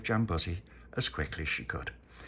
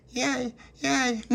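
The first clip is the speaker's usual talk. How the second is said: very high little squeal of delight; high-pitched child's yay; toddler cheer; 3 dB louder than usual. Acted. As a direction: cheer like a tiny excited girl. Toddler cheering in acted story.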